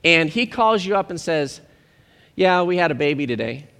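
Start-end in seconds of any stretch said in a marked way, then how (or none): 2.4-3.7 low-key, casual report of news; unimpressed. Acted. New father calls a friend with baby news, unimpressed. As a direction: say it as if it were no big deal. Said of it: "And not that big of a deal".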